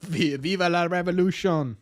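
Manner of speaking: mock-French rallying cry; French accent. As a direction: put on a playful French accent. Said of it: "That was my French accent".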